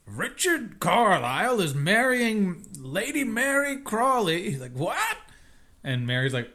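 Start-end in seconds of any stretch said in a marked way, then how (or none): 0.0-4.5 slow, high, swooping disbelief; astonished reading aloud. Retold or imitated. Robert reading the newspaper announcement, slow mock astonishment. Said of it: "Robert is the one reading it". 4.7-5.2 very high, rising yelp of disbelief; mock shock. Robert reacting to the engagement in the paper, mock shocked.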